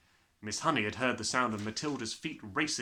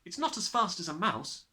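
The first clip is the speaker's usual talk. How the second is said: Matilda; 6 semitones higher, 4 dB louder than usual. Acted.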